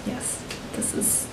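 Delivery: soft, slow, breathy yes; delighted whisper. Retold or imitated. Viewer discovering a new favourite show, delighted whisper. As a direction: sigh it like you found the one. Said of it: "I was like".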